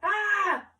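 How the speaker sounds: panicked scream, high and loud. Acted. Photographer screaming as his camera fails, loud panicked scream. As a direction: let out a sudden shriek of alarm. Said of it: "screamed the photographer".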